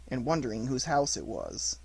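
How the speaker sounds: ordinary delivery, nothing marked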